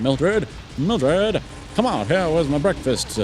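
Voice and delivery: lightly voiced farmer calling for breakfast; farmer calling wife. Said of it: "He's like".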